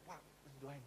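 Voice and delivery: very soft, low murmur; imitated whispering. Wife quietly asking the pastor for prayer, imitated whisper. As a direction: murmur it privately, as if confiding. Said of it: "dia kalau ngomong selalu bisik-bisik".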